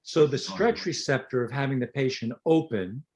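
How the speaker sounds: usual speaking voice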